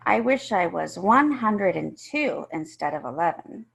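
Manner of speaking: plain reading voice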